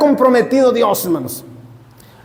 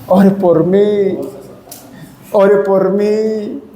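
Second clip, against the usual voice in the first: mock plea; much slower than usual. Mocking a sick person's plea.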